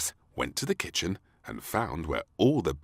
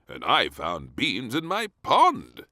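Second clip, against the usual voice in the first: slow, louder blustering admiral; 6 semitones higher, 7 dB louder than usual. A narrator's reading. Admiral complains of beans in his pond. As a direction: report it slowly like an old admiral. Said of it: "said the Admiral".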